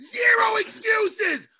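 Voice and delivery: high, loud, slow shout; a loud, slow, emphatic shout. Real, not acted. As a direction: hammer out each word. Ranting at fighter.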